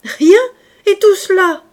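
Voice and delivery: very loud, high incredulous outburst; mocking disbelief. A narrator's reading. Traveler scoffing at the innkeeper's lie, loud and incredulous. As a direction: scoff at the full kitchen. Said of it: "L'homme éclata de rire".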